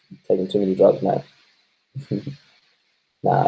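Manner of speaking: ordinary delivery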